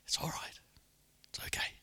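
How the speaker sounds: soft, breathy whispered reassurance; reassuring whisper. Acted. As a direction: whisper comfort to a guilty listener. Comforting whisper as reassuring Jesus.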